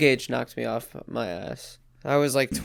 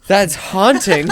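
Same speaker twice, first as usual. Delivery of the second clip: drawn-out amazed exclamation; 6 semitones higher, 10 dB louder, slower than usual. Real, not acted. Reacting to absurd mooncake story.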